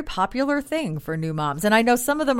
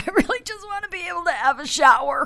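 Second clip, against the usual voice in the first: exasperated new mom; 10 semitones higher than usual. Retold or imitated. Exhausted new mom quoted.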